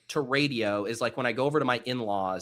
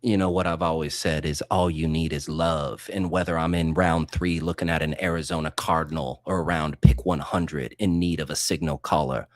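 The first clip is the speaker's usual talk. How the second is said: smooth jazz DJ; 9 semitones lower than usual. Acted. Musing to his cohost on air, low smooth jazz DJ voice. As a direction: purr it like a late-night jazz DJ. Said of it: "must talk like they're hosting a smooth jazz radio station".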